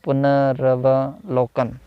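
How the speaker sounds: पुनरवलोकन said slowly with a short a after र; slow careful pronunciation. Shown as a demonstration. Teacher slowly pronounces पुनरवलोकन for the class. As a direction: spell out पुनरवलोकन syllable by syllable. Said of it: "वा नहीं होता".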